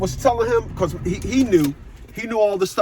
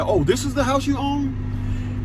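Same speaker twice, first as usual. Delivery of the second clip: dad surprised; 6 semitones higher, 3 dB quieter, slower than usual. Retold or imitated. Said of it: "He said".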